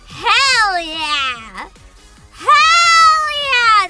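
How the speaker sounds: excited cheer. Real, not acted. VTuber cheering the VR treadmill workout idea, excited cheer. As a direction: belt it out, stretching the vowel.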